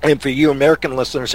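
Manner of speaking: ordinary delivery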